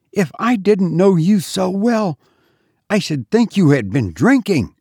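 high, scolding disbelief; exasperated mistress. A narrator's reading. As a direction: reproach the maid, starting high. Mistress scolds the bewildered maid.

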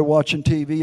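ordinary delivery

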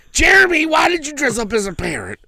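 put-on high, loud scold at the kid; scolding yell. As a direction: call the boy out sharply. Mother scolding son in costume.

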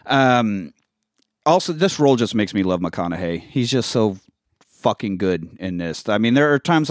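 normal talking voice

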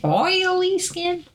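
"Oily skin?" drawled high and slow in Southern accent. Shown as a demonstration. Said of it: "My southern accent".

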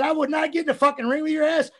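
mock-furious yell at a lazy rookie; retold angry yell. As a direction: bark it at him like you mean it. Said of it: "I said".

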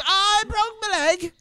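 very high, drawn-out mock wail; Chloe. Host retelling contestant's leg injury, high drawn-out impersonation. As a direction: mimic a contestant wailing in pain, high and slow. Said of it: "She goes".

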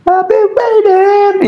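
sung high and slow, way up in falsetto; high falsetto wail. Retold or imitated. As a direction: reach for the top note in falsetto. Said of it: "he would go up".

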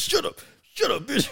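very high squeaky mock cat telling her off; cat's voice. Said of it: "Man said".

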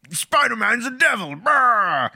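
high, blustering newspaper boss; J. Jonah Jameson rant. Retold or imitated. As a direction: bellow it like an angry editor. Jameson ranting impression.

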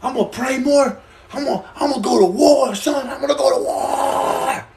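high, fired-up battle cry; hyped-up vow. Retold or imitated. Recalling yesterday's take-this-week-on mood, hammed-up hype. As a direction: psych yourself up, pitch climbing. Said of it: "I was like".

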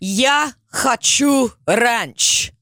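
high, very slow drawn-out demand; teacher begging for ranch, slow and hammed-up; slow mock demand. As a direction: stretch each word like a stubborn plea. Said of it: "That means I want ranch".

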